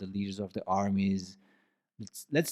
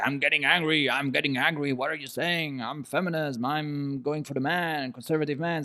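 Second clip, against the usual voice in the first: higher-pitched, hammed-up indignant protest; mock angry listener; 6 semitones higher than usual. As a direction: play an offended listener getting worked up, half in jest. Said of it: "then it's more like".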